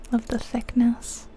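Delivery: ordinary delivery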